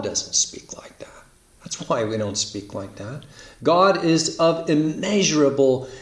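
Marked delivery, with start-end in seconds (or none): 0.0-3.2 dropped to a whisper. Acted. Preacher drops voice for emphasis.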